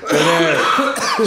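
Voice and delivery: cracking up mid-sentence; laughing. Real, not acted. Host cracking up about the guest's worm video, laughing.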